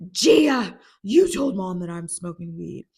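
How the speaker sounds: imitating an outraged sister's accusation; accusing yell. Rue confronts Gia about telling Mom, accusing yell.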